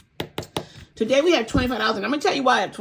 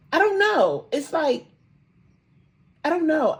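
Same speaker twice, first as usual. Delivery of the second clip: higher, choked-up real emotion; fighting back tears, voice lifting; emotional; 8 semitones higher, 4 dB louder than usual. Real, not acted. Speaker moved after reading the affirmation, choked up. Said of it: "I got emotional saying that".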